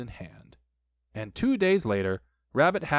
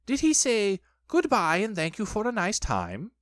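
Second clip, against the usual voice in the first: higher-pitched Rabbit voice; Rabbit questioning; 7 semitones higher than usual. A narrator's reading. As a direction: question him in a raised character voice. Rabbit questioning Christopher Robin, voiced.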